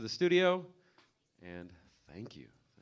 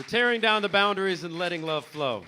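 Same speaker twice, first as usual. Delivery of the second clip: loud; 7 semitones higher, 11 dB louder than usual. Real, not acted. Host hypes crowd.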